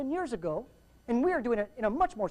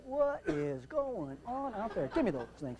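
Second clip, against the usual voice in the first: slow, softer mock bafflement; baffled controller; 7 dB quieter, slower than usual. Controller in the tower spotting the commotion, baffled. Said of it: "like that".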